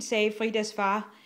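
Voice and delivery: usual speaking voice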